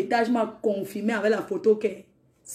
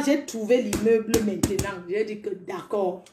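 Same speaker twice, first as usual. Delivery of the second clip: ordinary talk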